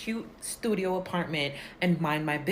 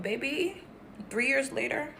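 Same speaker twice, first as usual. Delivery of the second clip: sassy aside; 5 semitones higher, 5 dB quieter than usual. Real, not acted. Sassy turn to the listener.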